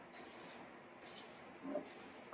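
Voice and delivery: whispered monkey tisking, mocking him back. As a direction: tsk at him like cheeky monkeys. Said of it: "the monkeys only stamped their feet".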